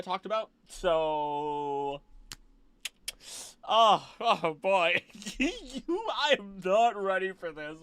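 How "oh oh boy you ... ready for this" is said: nervous excitement. Real, not acted. Streamer bracing for the stream, loud and giddy. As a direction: brace for it with giddy dread. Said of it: "but I'm excited".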